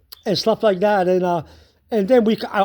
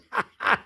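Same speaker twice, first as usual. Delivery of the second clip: soft run of chuckles; real laugh; 6 dB quieter than usual. Real, not acted. Laughing at sister's idea that cars have feelings, amused. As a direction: chuckle away at the absurdity.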